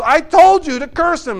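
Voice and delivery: mock-furious king scolding; angry king. The king scolding Balaam, hammed-up angry shout. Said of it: "He goes".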